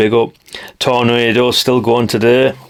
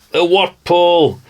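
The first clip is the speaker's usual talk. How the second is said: drawn-out, higher, delighted cry of the name; delighted exclaim; 8 semitones higher, slower than usual. Real, not acted. Man spotting an old antenna in a catalogue, delighted exclaim.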